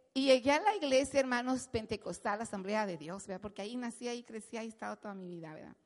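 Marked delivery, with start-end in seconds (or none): none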